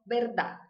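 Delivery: "verdad" with b and no final e; Spanish pronunciation. Shown as a demonstration. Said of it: "em espanhol".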